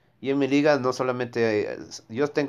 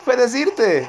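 taunting; 10 semitones higher, 5 dB louder than usual. Real, not acted. Taunting enemy robot in game.

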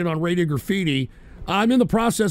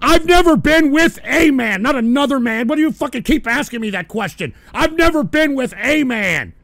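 indignant denial; 6 semitones higher, 4 dB louder than usual. Real, not acted. Angry denial to repeated question.

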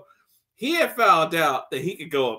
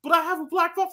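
mock whiny kid; 12 semitones higher, faster than usual.

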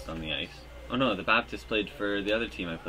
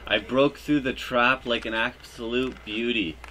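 ordinary delivery

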